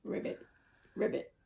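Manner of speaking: soft, voiceless ribbits; low-key frog noises; frog croak. Acted. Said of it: "In a frog voice".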